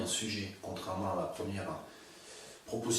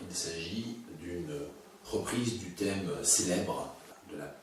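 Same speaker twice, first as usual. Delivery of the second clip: normal talking voice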